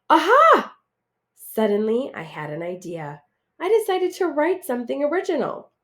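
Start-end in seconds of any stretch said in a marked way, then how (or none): 0.1-0.7 sudden eureka. Acted. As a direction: gasp then a high, loud Aha. Sudden idea.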